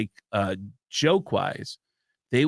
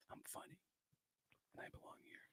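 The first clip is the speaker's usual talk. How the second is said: barely audible whispered mantra; private whisper; 37 dB quieter, much faster than usual. Retold or imitated. Nervous comic psyching himself up before going on, whispering. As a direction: whisper it only to yourself.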